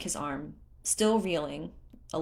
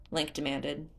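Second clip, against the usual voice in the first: usual speaking voice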